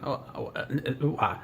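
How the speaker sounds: softly mocking a stumped stammer; flustered stammering. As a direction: imitate someone fumbling quietly for an answer. Retells rival unable to answer.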